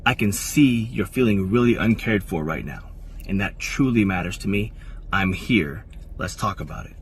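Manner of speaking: soft, calm reassurance that fades out. Shown as a demonstration. Man calming his upset partner, softly and calmly. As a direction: gently acknowledge her feelings. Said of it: "you talk really calmly and really soft".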